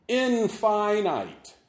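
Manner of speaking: Infinite as in-FY-nite, slow with long I's; lengthened I sounds. Shown as a demonstration. Preacher on a word for God, Infinite with long I sounds. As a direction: stretch both I's in Infinite like "eye". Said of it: "Lengthen those I sounds".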